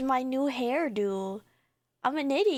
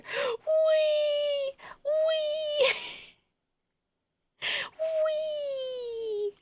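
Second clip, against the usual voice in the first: very high, drawn-out "wee"; high gleeful glide; playful squealing; 12 semitones higher, much slower than usual. Real, not acted. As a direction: squeal as if being spun around. Spinning the model round.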